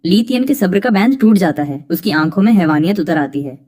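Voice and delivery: ordinary delivery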